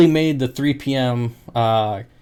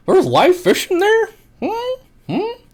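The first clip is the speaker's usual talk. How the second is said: much higher, hammed-up puzzled customer; mocking disbelief. Mocking the UPS worker puzzled by live fish, much higher. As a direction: squeak the baffled question.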